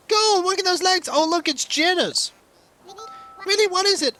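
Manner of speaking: high, bright-eyed cartoon villager; chirpy villager voice. Streamer voicing a game villager, high and chirpy. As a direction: read it like an eager little game character. Reading villager dialogue aloud.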